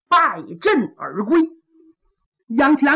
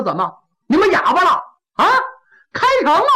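louder, quick impatient challenge; impatient demand; 5 semitones higher, 5 dB louder, faster than usual. Acted. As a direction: snap at the silent soldiers. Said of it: "七郎说". (不耐烦地高声质问)

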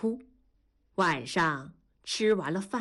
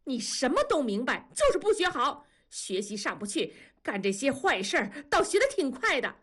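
higher, fast angry tirade; mother erupts at her son, furious and fast; furious outburst; 8 semitones higher than usual. A narrator's reading. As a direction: explode at the child in a shrill rant. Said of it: "大吼一声".

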